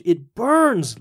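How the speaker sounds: high cry of burning pain; trapped devil crying out in pain; pained scream. A narrator's reading. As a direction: yelp as if scalded. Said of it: "he could hear Old Nick start to scream".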